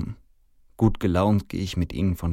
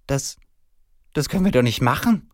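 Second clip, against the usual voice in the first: high, halting protest; stammering dismay; 7 semitones higher, 6 dB louder than usual. A narrator's reading. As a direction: stammer a shocked objection. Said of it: "stottert sie".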